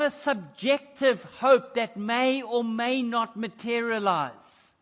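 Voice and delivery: loud, high preaching with big emphasis; full-force preaching. Acted. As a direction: preach it out to the congregation, forceful and rising.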